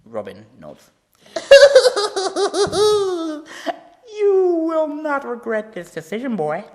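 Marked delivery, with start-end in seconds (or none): 1.3-6.6 high, loud manic laugh into a gloating promise; cackle, then gloat over the boy; Joker cackle. Acted. Joker delighted that Robin agrees to hear a joke.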